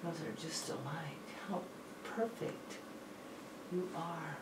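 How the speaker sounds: soft breathy whisper. Real, not acted. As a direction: murmur it under your breath. Quiet aside during test.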